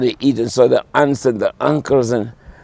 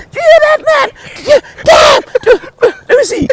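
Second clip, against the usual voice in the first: much higher, louder delighted cries; amazed shout; 7 dB louder, faster than usual. Retold or imitated. Relatives at the table shouting for more, much higher and louder.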